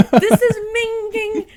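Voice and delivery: high, loud, drawn-out mock outburst; imitating a clip. Fan re-enacting a favourite game clip, high hammed-up cry. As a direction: laugh through a slow, high imitation. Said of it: "the one that is like".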